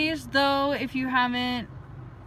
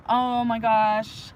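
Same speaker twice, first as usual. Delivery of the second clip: usual speaking voice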